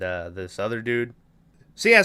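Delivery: ordinary talk, nothing marked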